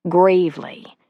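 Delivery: normal talking voice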